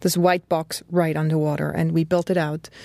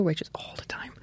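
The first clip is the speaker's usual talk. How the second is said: whispering; 3 semitones lower, 11 dB quieter than usual. Real, not acted. Aside about browser homepage.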